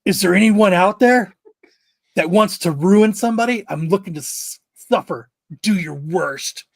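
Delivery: loud theatrical plea; hammed-up dare to the crowd; mock dramatic call.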